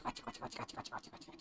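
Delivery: soft whisper repeated; whispered got you. Real, not acted. Holding her cat close, whispering playfully. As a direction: hush it into the cat's fur.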